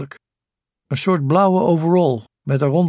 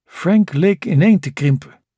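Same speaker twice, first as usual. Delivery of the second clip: usual speaking voice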